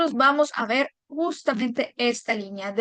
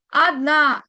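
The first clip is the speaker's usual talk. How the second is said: "Adna" with a long, falling last a; stress on last syllable; 5 dB louder than usual. Shown as a demonstration. Teacher showing learners where the accent falls, "Adna" stressed on the a. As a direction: stress the final a of "Adna", louder. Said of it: "en la a".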